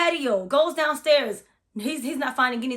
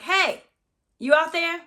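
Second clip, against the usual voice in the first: raised call into the house; 6 semitones higher than usual. Retold or imitated. Calling out to the friend she thinks came home, raised voice. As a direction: call down the hall, unsure.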